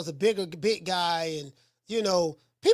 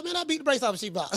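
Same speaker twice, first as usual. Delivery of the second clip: laughing boast; 3 dB quieter, faster than usual. Real, not acted.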